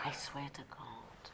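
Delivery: conspiratorial whispered aside. Retold or imitated. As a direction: hush it like a secret. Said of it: "a guy came up to me afterwards and said".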